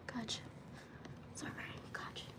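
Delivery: soft soothing whisper. Real, not acted. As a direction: whisper it gently.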